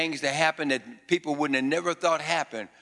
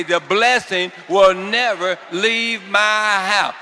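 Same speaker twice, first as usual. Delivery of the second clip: slow, louder shout of faith; full-voiced congregational cry; shouted declaration; 5 semitones higher, 8 dB louder, slower than usual. Shown as a demonstration. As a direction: shout it out with the crowd. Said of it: "Shout it out".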